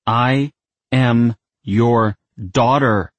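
I am your daughter, slow and separate; each word punctuated. Acted. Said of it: "punctuating each word".

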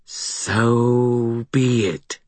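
slow, high, eerie hiss. A narrator's reading. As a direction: hiss it like something inhuman. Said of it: "hissed the voice from the stone".

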